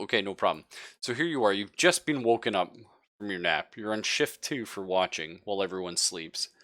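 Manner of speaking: ordinary talk, nothing marked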